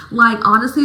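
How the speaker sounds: ordinary talk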